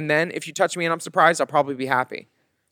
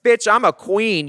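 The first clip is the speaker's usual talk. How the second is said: sassy comeback; 6 semitones higher, 4 dB louder than usual. Retold or imitated. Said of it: "And I was like".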